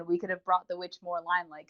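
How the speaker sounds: usual speaking voice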